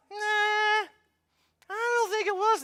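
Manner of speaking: high, loud doubtful neighbor voice. Retold or imitated. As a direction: scoff it off as a skeptical neighbor. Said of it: "he's like".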